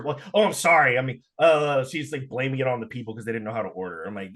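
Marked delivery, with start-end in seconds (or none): 0.3-1.0 louder mock apology; retold insincere sorry. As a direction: toss out a loud, fake sorry. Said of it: "her insincere".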